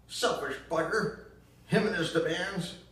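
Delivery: put-on high, loud bitter accusation in a southern accent; bitter southern mother. Ma blaming Gramps for Gran's death, loud southern bitterness. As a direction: spit the blame out in a shrill Southern voice. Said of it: "that nasty tune of hers".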